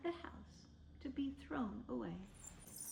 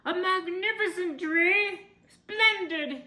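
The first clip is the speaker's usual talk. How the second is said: high, loud, delighted cry; excited Mister Willoughby; 9 semitones higher, 12 dB louder than usual. A narrator's reading. Mister Willoughby cries out at the tree, high and loud. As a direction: exclaim at the tree in glee. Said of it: "he cried".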